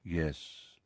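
low, hushed admission; quiet. A narrator's reading. Nick admits it to Harry, quietly. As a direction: answer very softly and low. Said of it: "said nearly headless Nick quietly".

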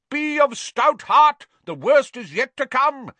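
high, loud, gallant encouragement; yelled. A narrator's reading. Knight running through pictures ahead encourages followers, yelling. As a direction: yell it slowly and grandly as a heroic knight. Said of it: "yelled the knight".

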